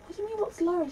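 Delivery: high-pitched baffled query; retold puzzled question. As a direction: voice mock puzzlement, pitched well up. Retelling a puzzled exchange.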